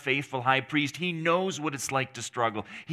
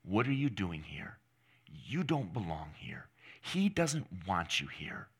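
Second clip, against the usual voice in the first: low, hushed taunting voice; quietly taunt, sinking toward a whisper; mild devilish hiss; 5 semitones lower, 5 dB quieter than usual. Acted. Preacher voicing the devil at the struggling believer, hushed hiss. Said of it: "the devil, will scream and shriek and hiss".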